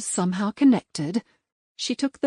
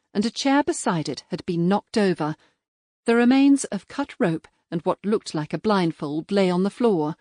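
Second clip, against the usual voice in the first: ordinary delivery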